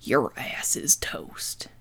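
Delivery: low, slow whisper; slow whispered menace; whispered threat. Acted. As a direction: breathe it like a menacing secret. Dramatic opening line to camera.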